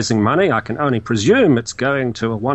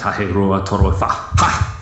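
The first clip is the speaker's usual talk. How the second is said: lower, mock martial arts count; mock ninja; 6 semitones lower, slower than usual. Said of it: "he sounds like a ninja".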